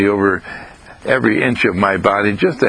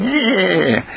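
high, drawn-out imitation neigh; whinny it like an old horse; 10 semitones higher than usual. Imitating a crackly singing voice as an old horse, whinnying. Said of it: "it's an old horse showing".

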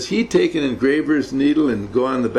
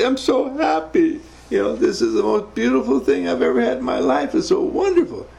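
a husband teasing his tearful wife with a squeaky high imitation; wife's overjoyed voice; 12 semitones higher than usual. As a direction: lift way up into a happy wife's voice.

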